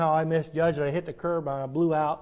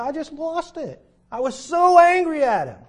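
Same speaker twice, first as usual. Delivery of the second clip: angry; 10 semitones higher, 5 dB louder than usual. Retold or imitated. Preacher recalls losing his temper.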